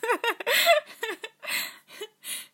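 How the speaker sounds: long, loud, high laughter; giggle fit. Real, not acted. Voice actor cracking up over a silly line, helpless laughter. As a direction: lose it completely. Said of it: "all, like, giggly".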